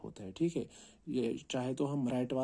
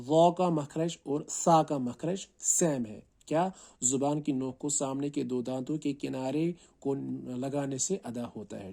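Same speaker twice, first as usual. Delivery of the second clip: ordinary talk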